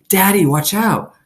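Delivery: high, loud warning cry; scream. A narrator's reading. Opal screams to her father as the dog charges, high and loud. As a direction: shout a warning to Daddy. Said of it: "I screamed".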